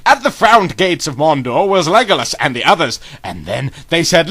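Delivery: usual speaking voice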